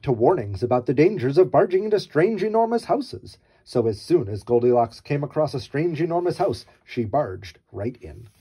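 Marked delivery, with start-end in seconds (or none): none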